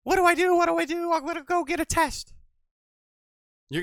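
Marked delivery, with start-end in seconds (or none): 0.0-2.3 high, whiny mock voice of a clueless person; mock dumb voice. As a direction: play the clueless guy, pitched way up. Mocking a naive person.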